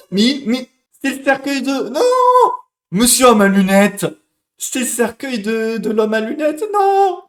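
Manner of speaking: high loud horrified cries; real horror, voice cracking high; horrified shouting. Real, not acted. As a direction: yell out the realization in horror. Realizes he killed character.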